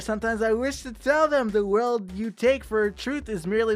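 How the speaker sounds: heartfelt storyteller voice; earnest poem reading. A narrator's reading. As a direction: plead gently, like a caring spirit. Reads poem aloud dramatically.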